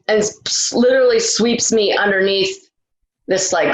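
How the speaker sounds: ordinary talk, nothing marked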